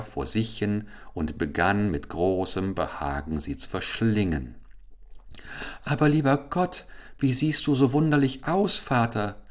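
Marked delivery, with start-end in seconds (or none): 5.8-9.4 put-on high, startled young princess; princess crying out. A narrator's reading. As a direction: cry out in shock. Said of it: "rief auf einmal die Prinzessin Amarza".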